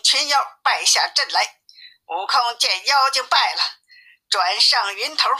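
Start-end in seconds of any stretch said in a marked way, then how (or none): none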